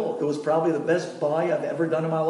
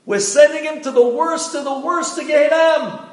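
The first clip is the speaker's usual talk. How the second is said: higher and louder, proclaiming the sentence; booming verdict; 11 semitones higher, 8 dB louder than usual. Acted. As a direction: announce it like a heavenly judge. Heavenly court announces verdict loudly.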